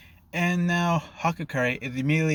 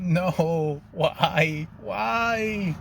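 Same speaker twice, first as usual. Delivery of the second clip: drawn-out, theatrical wail of despair; half-scream each word and stretch it out like it's doomsday; 5 semitones higher, much slower than usual. A narrator's reading. Classmates in the hallway as if the world ends, screaming out. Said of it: "screaming out".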